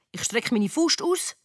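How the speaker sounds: ordinary delivery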